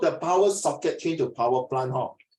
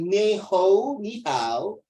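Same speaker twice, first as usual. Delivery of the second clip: Cantonese-style greeting, pitched up at first; Hong Kong accent; 3 semitones higher, 3 dB louder, much slower than usual. Shown as a demonstration. Teacher showing how Hong Kong people say hello, Hong Kong accent. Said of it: "香港人".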